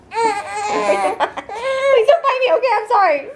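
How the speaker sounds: high, stretched-out plea with nervous laughter; whimpering, squeaky apology; nervous pleading. Real, not acted. Nervous plea to nursing baby.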